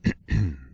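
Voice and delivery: short, awkward clearing of the throat; brief preparatory ahem. Acted. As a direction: clear your throat before a hard admission. Awkward before changing the subject.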